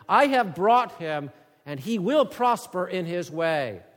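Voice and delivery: loud, high preaching voice; forceful scripture reading. Acted. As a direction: read God's words like a summons. Reads God's words about Cyrus.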